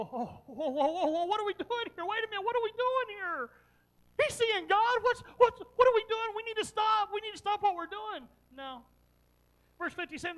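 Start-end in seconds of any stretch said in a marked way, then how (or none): 0.0-8.3 loud, high mock panic; alarmed outburst. Preacher voicing what Stephen's accusers should have said, loud mock alarm. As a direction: act out a startled crowd yelling to stop. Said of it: "should have made him go".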